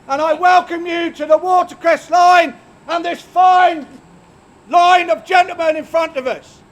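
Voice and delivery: loud high proclamation voice; town crier. Acted.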